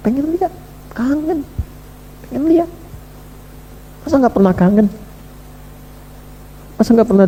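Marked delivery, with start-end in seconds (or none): 0.1-2.7 high, soft, aching longing; yearning. Pretend. Preacher to congregation, voicing a soul missing God, soft yearning. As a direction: plead like a homesick child.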